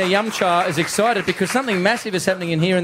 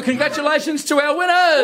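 cheering; 7 semitones higher than usual. Real, not acted. Host cheers the winners.

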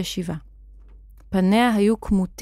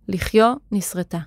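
ordinary delivery, nothing marked

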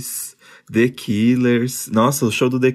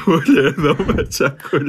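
laughing quote; 2 semitones higher than usual. Retold or imitated. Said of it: "briga de marido".

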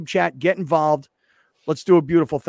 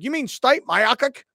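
high, slow botched name; imitating Lawhead; 10 semitones higher, slower than usual. Host mimicking colleague falling for the setup, high-voiced. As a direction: mimic the colleague, pitched up and drawn out. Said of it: "And he's like".

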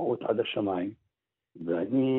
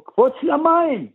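high, loud urgent cry; shouting from across the water; retold shout; 5 dB louder than usual. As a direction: yell the warning with everything. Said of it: "צועקת".

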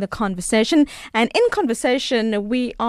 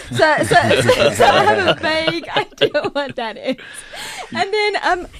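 laughing; 6 semitones higher than usual. Real, not acted. Laughing about the ratatouille movie.

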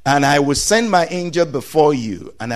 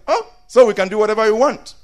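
put-on high, fast gleeful kid; mock excited child; 9 semitones higher, 3 dB louder, much faster than usual. Preacher voicing kids celebrating their freedom, fast and excited. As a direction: rush it out like a delighted child.

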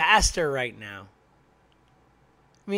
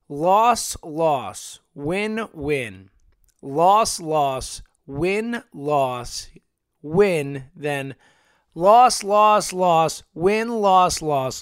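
punches each loss louder and louder, clipped single words; exasperated loss tally; 7 dB louder, much slower than usual. Real, not acted. Fan listing the team's losses in disgust, counting out loud. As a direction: ticks off the losses one by one, fed up. Reciting the Phillies' run of losses.

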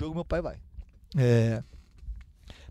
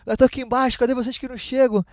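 a mildly impatient dad calling, pitched higher; lightly voiced impatient father, higher pitch; impatient dad on the phone; 8 semitones higher than usual. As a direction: voice the dad asking where they are, higher and a bit hurried.

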